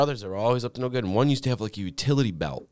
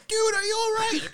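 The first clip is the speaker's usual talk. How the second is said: much higher call down to him; mock worried call; 3 dB louder than usual. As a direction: shout down checking on him. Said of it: "they're like".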